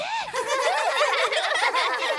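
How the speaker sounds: high loud yelp of pain turning to a laugh; shrieking yelp-laugh. Acted. Madame Elf yelps after a child stings her, high squeal. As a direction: squeal like you just got stung.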